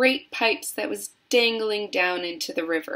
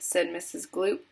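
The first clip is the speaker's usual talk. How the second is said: ordinary delivery, nothing marked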